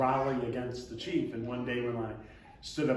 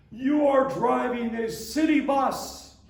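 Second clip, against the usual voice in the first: high, loud, slow indignant tirade; furious scolding; 11 semitones higher, 8 dB louder than usual. Retold or imitated. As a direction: scold him like he broke the law. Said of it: "this woman went ballistic".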